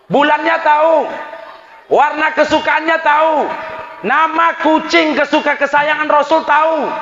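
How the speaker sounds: high, driving sermon cadence; fiery preaching. Acted. Preacher warning the congregation, in a high fiery sermon voice. Preaching about love of Prophet.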